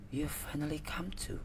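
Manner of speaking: whispered, very soft. Acted. Stranger whispering to the waking student. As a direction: breathe it like a secret.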